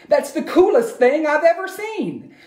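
high surprised mother; exclaiming mother. Acted. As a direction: gasp it out as the delighted mum. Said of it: "his mother exclaimed".

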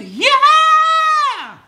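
very high, loud, held shout; belted song finish. Pretend. Singer ending a silly song about packs, high belted yell. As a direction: belt it out as a big final note. Climax of his improvised song.

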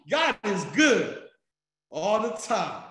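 preacher's shout. Real, not acted. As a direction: praise like a preacher to the congregation. Preacher call to congregation.

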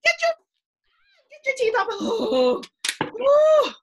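loud, high pretend ouch; mock pain shout. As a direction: shriek at the biting toy. Pretend alligator bites teacher.

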